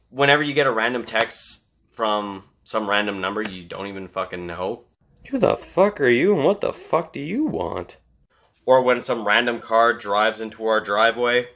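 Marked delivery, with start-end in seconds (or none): 5.3-8.0 a touch of annoyed demand, mostly in his own voice; half-hearted imitation of a startled homeowner; mild mock demand. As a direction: quote it lightly irritated. Replaying the movie line again.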